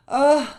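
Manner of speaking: sigh. Acted. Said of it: "soupira le loup".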